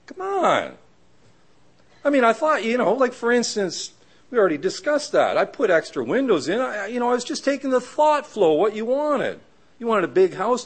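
high, airy excuse-making voice; breezy contractor. Retold or imitated. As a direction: play the careless builder brushing it off. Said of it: "He says".

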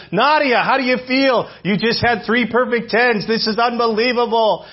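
thrilled reporter bursting with amazement; ecstatic interviewer. Retold or imitated. Sportscaster to a gymnast after her perfect scores, ecstatic. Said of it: "Jim McKay was ecstatic for her".

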